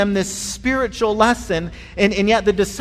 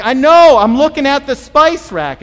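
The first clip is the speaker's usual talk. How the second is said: loud, higher annoyed call; impatient shout; 5 semitones higher, 10 dB louder than usual. Retold or imitated. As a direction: yell back, a bit irritated. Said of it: "And I'm like".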